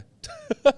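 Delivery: sudden high laugh; high burst of laughter. Real, not acted. Guest laughs at his own story, high burst of laughter. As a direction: let a bright laugh burst out.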